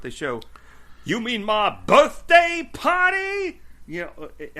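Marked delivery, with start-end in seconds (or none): 1.0-3.6 high, loud, drawn-out shout in a put-on English accent; [name]'s mock shout. Cohost imitates the promo line from tonight's episode, mock English-accented shout. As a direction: shout it slowly, hamming up every word. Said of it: "he, like, shouts it out".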